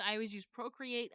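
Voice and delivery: usual speaking voice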